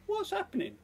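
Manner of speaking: very high squeaky woman's voice; piping little reply. A narrator's reading. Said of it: "The little high woman's voice said".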